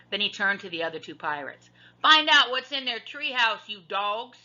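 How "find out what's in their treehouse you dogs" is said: higher, louder pirate captain order. A narrator's reading. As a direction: bark it at the crew. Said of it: "he said".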